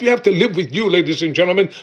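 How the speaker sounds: normal talking voice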